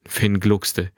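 usual speaking voice

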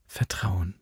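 soft, hushed affirmation; very soft single word; quiet confirmation. A narrator's reading. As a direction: confirm gently under the breath. She quietly confirms his word.

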